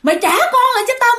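high, loud, fast ghostly shriek; spooky high-pitched demand; ghost demanding the child. Retold or imitated. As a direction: shriek it like a ghost wanting the baby back. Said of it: "nó kêu".

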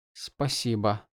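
soft, near-whispered thanks. A narrator's reading. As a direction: breathe the thanks quietly. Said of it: "прошептала Шалан".

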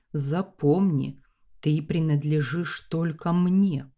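ordinary delivery, nothing marked

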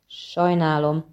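plain reading voice

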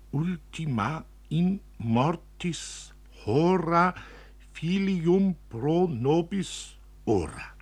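reading Latin. A narrator's reading.

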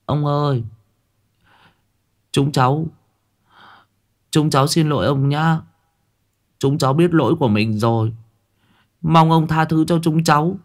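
put-on high, meek children's voice calling through the door; small kids begging forgiveness, crestfallen; dejected plea. A narrator's reading. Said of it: "ba thằng ỉu xìu cúi đầu nói vọng vào trong".

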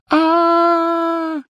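high piercing pain scream; burned hand shriek. A narrator's reading. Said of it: "Гермиона закричала от боли".

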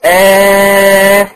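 a long, drawn-out, steady "آه"; held long aah. Shown as a demonstration. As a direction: stretch the "آه" out on one even note, like a vowel demo. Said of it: "يعني مثلًا الألف". ("آه" ممدودة بنفَس طويل وصوت ثابت)

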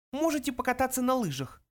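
high, snide mouse voice; mocking little mouse; caustic suggestion. A narrator's reading. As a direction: needle him in a tiny high voice. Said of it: "язвительно предложил Бенджи".

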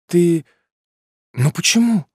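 put-on high, pleading girl's voice on the edge of tears; tearful plea. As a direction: plead with him, voice lifting high. Girlfriend pleads after breakup.

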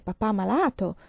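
plain reading voice

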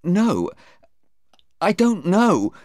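high-pitched, distressed reply; unhappy witness. A narrator's reading. Sarah cornered by the lawyer, unhappy. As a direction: plead ignorance on a high pitch. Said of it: "Sarah looked very unhappy".

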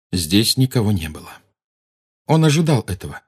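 usual speaking voice

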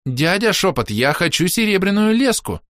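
put-on high insistent teen girl; demanding girl. A narrator's reading.

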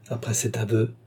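ordinary delivery, nothing marked

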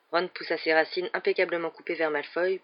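plain reading voice

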